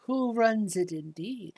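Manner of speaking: put-on soft, knowing old-lady informant; Olive Stillwater. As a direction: share underworld rumours softly, in an old shopkeeper's voice. Game master plays old shopkeeper.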